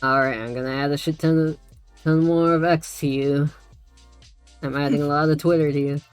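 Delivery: usual speaking voice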